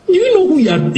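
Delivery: mock indignation. Mocking Peter's overconfidence.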